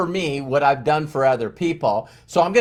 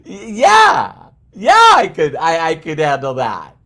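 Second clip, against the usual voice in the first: loud rattled yeah; 6 dB louder, slower than usual. Retold or imitated. Acting his answer after screaming example.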